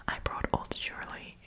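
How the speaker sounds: ordinary talk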